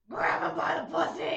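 loud death-metal growl; hammed-up Batman-style man voice. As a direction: put on an over-the-top manly roar. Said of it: "what do I say in my man voice".

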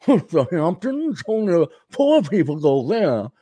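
drawn-out snobbish rich man; put-on upper-crust voice, first words stretched; Winston Howell. Rich castaway scorning the Hamptons, affected snobbish voice. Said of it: "anyone can do Winston Howell".

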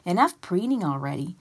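high-pitched shouted nagging. A narrator's reading. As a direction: shout to cut her off. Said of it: "and shouted".

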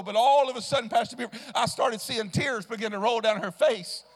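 loud, fervent sermon cadence; full-throated revival preaching; preaching at full force. Acted. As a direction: lift the room with it.

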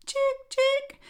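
very high whispered chick peep; high whispered chirp; tiny chick. Acted. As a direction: peep like a just-hatched bird. Hatchling's first sound.

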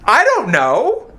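slow, stretched shrug; slow baffled I don't know; slow drawn out. Real, not acted. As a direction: drag it out, unsure. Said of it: "this is my answer".

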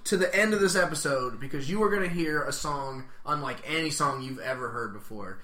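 mock-grand podcast announcer; announcer promo. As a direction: hype it up like a show promo. Plugs the show's ending.

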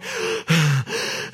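sharp panting gasp; imitated gasp. Pastor mimicking his own racing heart with a gasp. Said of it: "my heart is going".

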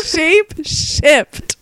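exaggeratedly slow, amused "shape shift"; correcting pronunciation. Shown as a demonstration. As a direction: stretch out "shape shift" through laughter. Teasing friend's slip on the word.

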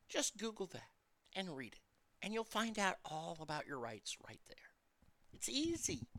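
hushed, secretive whisper; whispered aside. Acted. Audience aside, whispering the easy answer.

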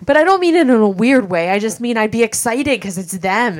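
high, loud protest; defensive. Real, not acted. Explaining to a teasing co-host, loud and defensive. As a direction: insist over the top of the teasing. Defending herself when teased about kids.

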